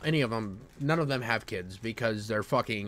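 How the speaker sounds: normal talking voice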